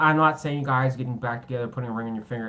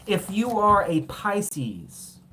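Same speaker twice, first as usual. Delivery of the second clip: high, slow drawn-out announcer call; 7 semitones higher, 5 dB louder, much slower than usual. Acted. Reader calling out to Pisces viewers, slow high announcer call. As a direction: stretch it out like a host introducing the next act.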